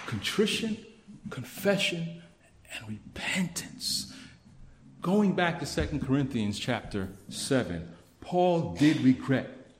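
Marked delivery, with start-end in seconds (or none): 0.0-4.1 fading to a whisper. Shown as a demonstration. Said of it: "what godly mourning looks and sounds like".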